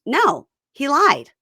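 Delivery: high, loud, drawn-out mock protest; indignant retort. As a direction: imitate an indignant denial, slow and punched. Retelling her denial in an argument.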